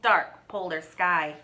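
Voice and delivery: plain reading voice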